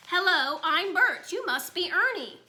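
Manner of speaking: puppet voice. A narrator's reading. Toddler greeting his father at dinner as a TV puppet. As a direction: ham it up as a cheery puppet.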